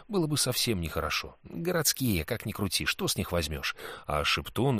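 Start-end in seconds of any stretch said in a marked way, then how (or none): none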